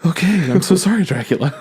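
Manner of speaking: gasp, then a high, hammed-up frightened squeak; mock cowering apology. A vampire cowers before angry Dracula, hammed-up high squeak. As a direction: play a vampire cowering before his master.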